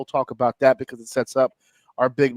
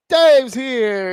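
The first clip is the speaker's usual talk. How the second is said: loud, higher hype for the co-host; excited introduction; 8 semitones higher, 6 dB louder than usual. Acted. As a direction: announce him like a ring entrance.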